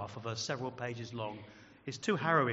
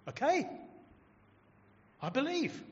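high, wide-eyed acceptance; eager new believer; 12 semitones higher than usual. Acted. Churchgoer responding to the preaching, bright-eyed belief. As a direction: pitch it up, simple and ready.

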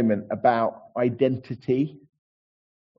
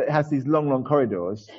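ordinary talk

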